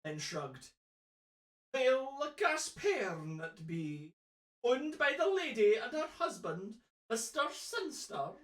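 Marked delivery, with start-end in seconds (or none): 1.7-8.3 put-on Scottish old man. Old man telling a stranger who owns it, stagey Scottish. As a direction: play the old local, swooping up on names. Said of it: "the old man exchanged a glance".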